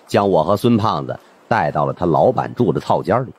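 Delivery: usual speaking voice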